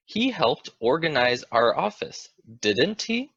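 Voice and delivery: "didn't he?" sweeping up high; rising tag question. Shown as a demonstration. Teacher shows a rising tag question to learners. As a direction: let "didn't he?" climb sharply upward. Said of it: "I used rising intonation for both".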